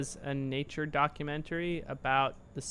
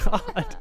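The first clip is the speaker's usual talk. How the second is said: put-on kid giggling; 4 dB louder than usual. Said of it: "impression of a child's laughter".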